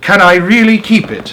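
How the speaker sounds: high, loud, disbelieving call. A narrator's reading. Young man calling up to Henry in disbelief.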